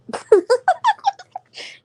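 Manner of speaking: loud high-pitched laugh; high burst of laughter. Real, not acted. Laughing at her own story, high laughter. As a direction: break into a squealing laugh.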